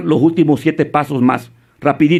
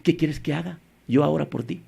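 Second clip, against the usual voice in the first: much softer, humble offer to God; softly offered prayer; 3 semitones lower, 9 dB quieter than usual. Acted. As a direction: voice a hushed, willing question. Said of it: "Pero no le decimos".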